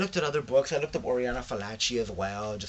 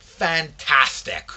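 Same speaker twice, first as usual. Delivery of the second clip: drawn-out, much louder gushing impression of a friend; mimicking a friend's favorite word, slow and loud; Paula's gushing fantastic; 5 semitones higher, 13 dB louder, much slower than usual. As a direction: stretch it out big and thrilled. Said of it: "She's like, she's like".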